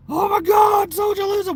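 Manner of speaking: mock shout. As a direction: shriek it like a hammed-up scare. Mocking fear of the word socialism.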